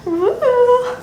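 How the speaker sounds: high-pitched "ooh" of delight; pleased ooh. Real, not acted. Sewer checking bow placement, pleased high ooh. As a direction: coo it high, pleased with the result.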